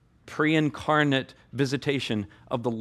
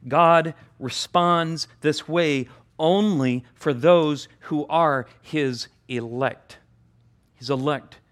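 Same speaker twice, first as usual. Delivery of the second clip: slow and deliberate; weighty sermon emphasis; slower than usual. Real, not acted. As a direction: lean on every word.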